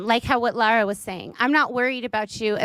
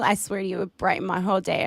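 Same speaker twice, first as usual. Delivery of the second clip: usual speaking voice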